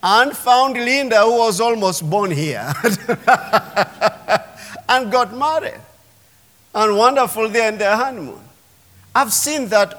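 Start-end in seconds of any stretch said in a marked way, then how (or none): 2.8-4.8 a light chuckle mid-story; light laugh. Real, not acted. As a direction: laugh fondly while telling it. Laughs about Linda.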